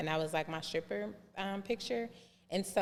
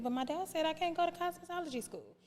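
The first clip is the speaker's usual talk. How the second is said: put-on young self protesting; 11 semitones higher, 4 dB quieter, faster than usual. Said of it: "And I was like".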